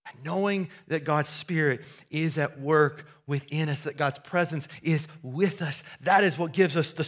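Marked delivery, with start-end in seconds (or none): none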